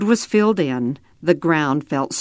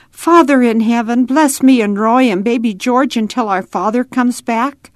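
put-on higher, louder child voice in earnest prayer; praying child; 6 semitones higher, 4 dB louder than usual. A narrator's reading. Ada asks God to bless the children, earnest child's prayer. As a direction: pray simply, as a little girl would.

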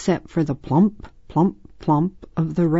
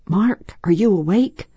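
ordinary delivery, nothing marked

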